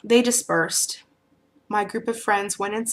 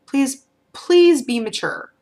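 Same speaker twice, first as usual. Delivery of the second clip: pleading; 6 semitones higher, 6 dB louder, slower than usual. Retold or imitated. Pleading with fuming friend.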